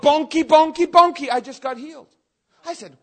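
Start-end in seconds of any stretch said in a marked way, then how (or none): 0.0-1.3 high, loud prophetic yell; yelling a prophecy. Retold or imitated. Preacher retells yelling a prophecy at the fire tunnel, loud and high. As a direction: belt it out like a prophecy pouring out. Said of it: "As you yelled".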